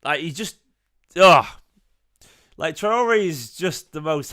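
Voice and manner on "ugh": groan of disgust. Real, not acted. Disgust at Traore.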